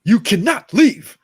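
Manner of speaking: loud, high, slow line reading; Jaden Smith. Retold or imitated. Son to father at the table, loud imitated command. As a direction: belt out each word in imitation. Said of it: "the way he said".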